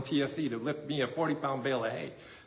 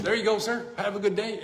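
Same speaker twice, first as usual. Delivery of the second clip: put-on bright teenage clerk; 5 semitones higher than usual. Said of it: "She's like".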